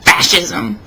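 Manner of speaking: usual speaking voice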